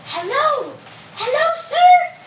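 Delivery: high-pitched mouse, drawn-out greeting; small creature piping up in a high voice; little mouse. Acted. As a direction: squeak it out high like a tiny mouse. Mouse character greets the lion.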